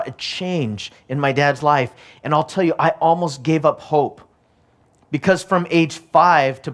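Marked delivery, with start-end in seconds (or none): none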